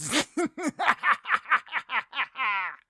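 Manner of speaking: high, loud villain cackle; evil cackle. Acted. Supervillain gloating to his henchman, booming evil cackle. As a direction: throw back your head and cackle. Evil laugh after world domination.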